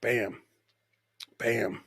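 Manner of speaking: ordinary talk, nothing marked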